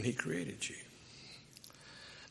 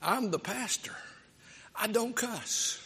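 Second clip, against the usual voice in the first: indignant pastor; 9 semitones higher than usual. Retold or imitated. Pastor objects to the kid.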